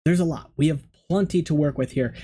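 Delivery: ordinary delivery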